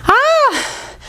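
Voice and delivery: sharp effort cry, high pitched; strained high yelp. Real, not acted. Straining through a rep.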